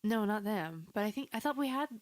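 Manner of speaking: ordinary talk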